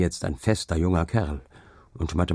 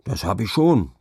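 calling out; 4 dB louder than usual. A narrator's reading. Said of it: "rief Ole".

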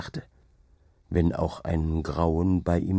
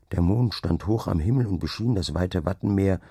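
plain reading voice

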